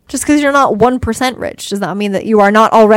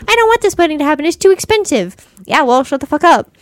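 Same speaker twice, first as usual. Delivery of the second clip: whiny dad; 6 semitones higher than usual. Retold or imitated. Dad voiced as quirky side character.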